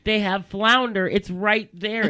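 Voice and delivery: loud, slow, spelling it out; slowly and loudly, as if to a dimwit; exasperated scolding. Retold or imitated. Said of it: "she's like".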